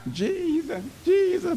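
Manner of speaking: high, soft pleading cry; crying out to Jesus. Shown as a demonstration. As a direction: plead upward in a thin high voice, almost breaking. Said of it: "You need to cry out".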